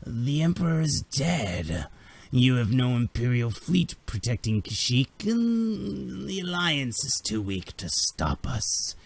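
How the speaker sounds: slow, drawn-out creature voice; menacing alien gloat, words stretched out; taunting alien invader. Acted. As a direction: taunt slowly in a put-on alien voice. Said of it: "one of them goes".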